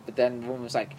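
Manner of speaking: ordinary talk, nothing marked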